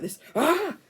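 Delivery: startled yell. Retold or imitated. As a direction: yelp as a rock comes flying. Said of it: "Then Gru's like".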